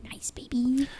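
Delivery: soft whisper. Real, not acted. Quiet sign-off aside.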